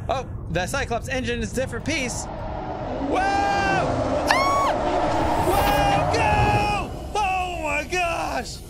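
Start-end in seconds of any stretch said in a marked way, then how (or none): 2.2-8.6 real terrified screaming. Real, not acted. Gamer screaming as a monster attacks, terrified. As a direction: scream as something grabs you.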